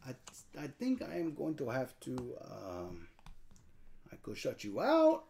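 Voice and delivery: mock French accent, hesitant; halting fake French accent; weird French voice. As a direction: think aloud as a put-on Frenchman. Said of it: "you get to talk in a weird voice".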